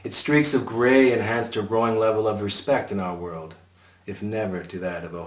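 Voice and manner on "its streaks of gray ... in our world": ordinary delivery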